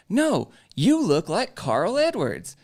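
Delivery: high, loud and slow; imitating a woman hollering her point; insistent retold yelling. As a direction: yell it insistently, stretching the words. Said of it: "she just starts yelling and yelling".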